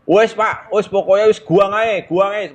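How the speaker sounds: high, loud egging on; goading assistant. Retold or imitated. Assistant egging on the angry conductor, high loud goading. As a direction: urge the boss on to throw them out. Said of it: "Manas-manasin gitu".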